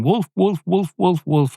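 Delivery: high urgent alarm calls; crying wolf. Pretend. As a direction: call out the warning like the boy. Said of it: "I'm crying wolf".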